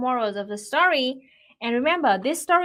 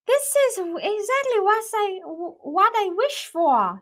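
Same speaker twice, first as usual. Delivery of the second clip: put-on high, louder, excited child; high overjoyed child's voice; thrilled little girl; 10 semitones higher, 5 dB louder than usual. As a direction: gush like a girl getting her wish. Girl thrilled with her gift.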